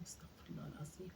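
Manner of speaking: istighfar whispered, استغفر الله العظيم almost silent; whispered prayer. Acted. Old woman alone after the call, whispered استغفر الله العظيم.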